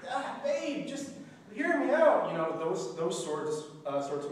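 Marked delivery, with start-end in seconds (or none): 0.1-2.3 pleading. Pretend. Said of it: "just be like".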